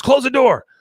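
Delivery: high, loud hissed command; loud urgent close the door; urgent order. Retold or imitated. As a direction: shout it high and sharp. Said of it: "And she goes".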